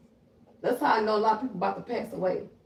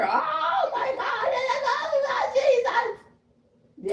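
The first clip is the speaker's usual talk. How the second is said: drawn-out wailing shriek, very high, laughing; over-the-top screaming; much slower than usual. Retold or imitated. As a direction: wail it slow like the world is ending.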